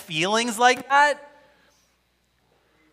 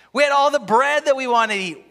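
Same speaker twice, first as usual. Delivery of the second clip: higher and fast, mocking the complainers; mock whining Israelites; 6 semitones higher, 4 dB louder, faster than usual. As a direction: rattle off their fond bread memory, mocking. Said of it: "They're like".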